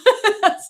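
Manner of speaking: a short loud burst of laughter; real amused laugh. Real, not acted. Laughing during the summary.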